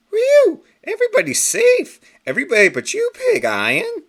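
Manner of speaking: very high and loud, then pointed accusation; a squeaky hero calling out the villain; triumphant hero. Acted. Captain Carrot confronts Pig Iron.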